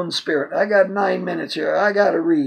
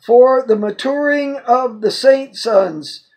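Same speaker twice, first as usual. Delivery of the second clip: raised, declamatory Bible reading; emphatic scripture reading; 3 semitones higher than usual. Acted. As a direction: read the verse out in a higher preaching voice. Reads scripture aloud emphatically.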